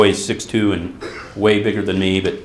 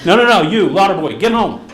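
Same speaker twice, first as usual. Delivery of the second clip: higher, louder mock-stern dad; teasing dad; 7 semitones higher, 4 dB louder than usual. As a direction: tease him like a father playing tough. Dad teasingly sends son home.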